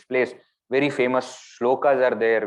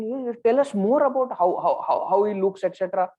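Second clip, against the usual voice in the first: much higher, eager pleading; eager friends; 9 semitones higher than usual. Retold or imitated. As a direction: beg for every detail. Friends eagerly begging for details.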